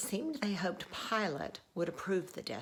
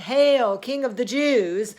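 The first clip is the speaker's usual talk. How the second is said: loud, raised-pitch acclamation; crowd's shout; 6 semitones higher, 13 dB louder, slower than usual. Acted. Company of soldiers mocking Jesus, loud chanting shout. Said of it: "continued to shout".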